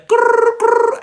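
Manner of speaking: grrr through the teeth like a cornered dog; mock growl at the ringing phone; growl of dread.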